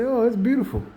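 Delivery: high, delighted gasp of admiration; imitating an admiring woman. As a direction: voice a woman charmed by the garden. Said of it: "a woman will walk past, say".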